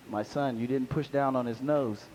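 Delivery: a soft, deep divine rebuke; voice of Jesus. Retold or imitated. Jesus answering the drowned man: low soft gentle scolding.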